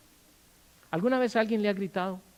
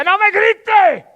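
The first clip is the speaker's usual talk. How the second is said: full-force, high-pitched yell; acted screaming argument, words drawn out; shouting match; 16 dB louder than usual. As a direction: bellow back like a spouse in a heated quarrel. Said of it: "no grito más porque se puede desarmar el micrófono".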